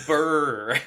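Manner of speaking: loud, high lip-trill brr; mock shiver brr. Mocking a shivering warm-up.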